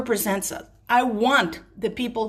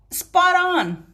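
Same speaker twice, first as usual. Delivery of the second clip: excited approval; 8 semitones higher, 4 dB louder than usual. Real, not acted. Cheering the Queen's speech.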